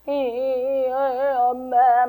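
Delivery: put-on drawn-out, stammering child's whine; whining child. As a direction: whimper like a clingy toddler. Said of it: "막 이러고 있어". (느리게 늘어지는 아이의 징징거림)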